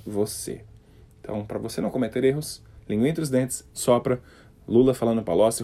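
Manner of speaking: ordinary talk, nothing marked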